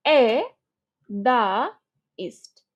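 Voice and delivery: এ, দা, ইস্ট as three chunks with pauses; split in three parts. Shown as a demonstration. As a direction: dictate এ, then দা, then ইস্ট separately. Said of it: "তিন ভাগে ভাগ করতে পারো". (এ, দা, ইস্ট তিন ভাগে থেমে থেমে)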